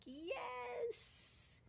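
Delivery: high cheer of relief; squealed little victory yes; excited yes. Retold or imitated. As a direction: celebrate like it finally works. Cheering internet works.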